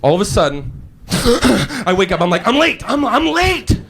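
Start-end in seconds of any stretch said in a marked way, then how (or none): 2.5-3.8 high-pitched panicked cry; acted jolt of alarm, pitched high; waking panic. New manager wakes up late for work, high-pitched panic. Jolts awake, realises he is late.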